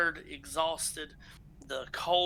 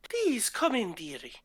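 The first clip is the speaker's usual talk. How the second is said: put-on old dwarf woman; 4 semitones higher than usual. Female dwarf fussing over the tired travelers, high and doting. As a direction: coo at them like a doting grandma. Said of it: "she goes".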